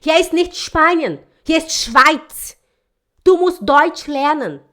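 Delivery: loud, slow, scolding Swiss clerk; a post office clerk scolds a student, slow and loud; stern Swiss rebuke. Retold or imitated. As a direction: bark the rules slowly at a foreigner. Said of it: "olhou pra mim e falou assim".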